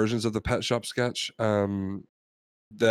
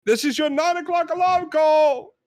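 very high-pitched British call to a parrot; hammed-up sing-out wake-up call; slower than usual. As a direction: holler high to wake a sleeping bird. Customer shouting to wake the parrot.

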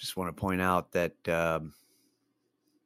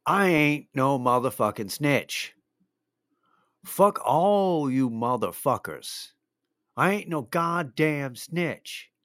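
retold rant, slow and a bit raised; half-hearted shout of a stranger in a grocery aisle; mock yell; 6 semitones higher, slower than usual. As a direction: imitate the guy yelling his snitch denial, stretched out. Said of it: "he basically yelled".

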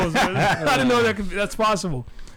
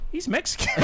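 retold girlfriend; 10 semitones higher than usual. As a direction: lift it high as a quoted woman. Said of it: "she goes".

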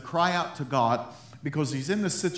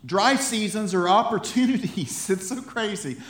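higher, louder, fervent exhortation; preaching at full force; 6 semitones higher, 4 dB louder than usual. Acted. Pastor rallying his congregation, preaching with full force. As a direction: drive it home to the church.

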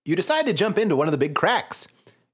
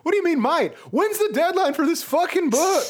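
mock outrage; 14 semitones higher than usual. Real, not acted.

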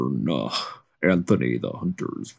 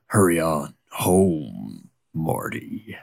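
southern drawl; slower than usual. Acted. Hunter warns the deputy to go home, slow Southern drawl. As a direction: warn him off slowly in a Southern drawl. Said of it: "southern drawl".

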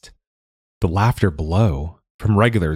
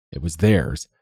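ordinary delivery, nothing marked

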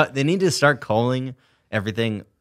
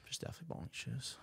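whispered echo; 26 dB quieter than usual. Retold or imitated. Echoing a friend mockingly.

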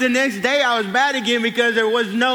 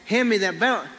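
ordinary talk, nothing marked

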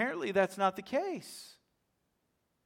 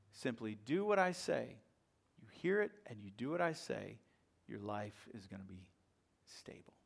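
softer and lower, ending on a whisper; hushed intimacy; 6 semitones lower, 5 dB quieter than usual. Acted. Preacher softly assuring the congregation of a stable life. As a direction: draw them in close, almost whispering.

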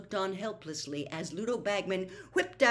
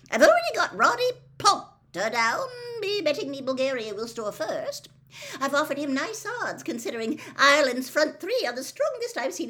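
loud, high, eager booming voice; jovial, excitable betting man; hearty bookmaker Bagman; 9 semitones higher, 7 dB louder than usual. A narrator's reading. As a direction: rattle off the bets with jovial gusto. Narrator plays Bagman, hearty bookmaker.

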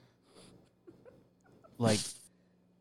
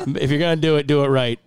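laughing; 5 semitones higher, 5 dB louder than usual. Real, not acted. Laughing through the advice.

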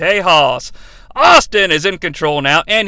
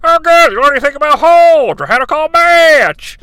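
higher, louder held-nose radio voice; muffled underwater mic voice; radio mode; 10 semitones higher, 5 dB louder than usual. Retold or imitated. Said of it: "he's back in radio mode now".

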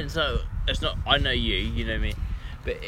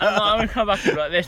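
put-on Australian accent; 6 semitones higher, 5 dB louder, faster than usual.

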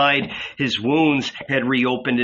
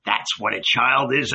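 usual speaking voice

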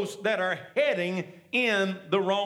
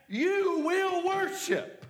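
higher, slow, ringing out; high drawn-out proclamation; 9 semitones higher, much slower than usual. Acted. Preacher voicing Jesus including the woman, high and drawn out. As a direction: lift it up and stretch it.

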